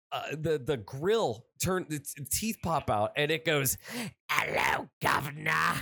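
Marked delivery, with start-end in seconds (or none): 4.3-5.7 mock monster-car catchphrase in put-on British; menacing cab. Killer cab bares its teeth at victims, hammed-up British menace. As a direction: growl out a hammed-up British greeting.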